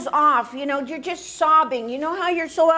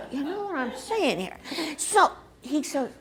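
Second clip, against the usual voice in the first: ordinary delivery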